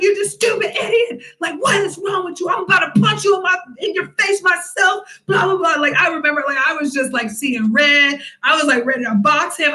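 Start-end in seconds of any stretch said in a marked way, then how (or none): 0.0-5.8 raised, high retold tirade; hammed-up angry telling-off; mock angry rant. Retelling a fight, shouting at the guy.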